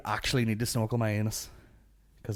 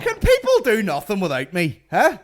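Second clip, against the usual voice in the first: ordinary talk, nothing marked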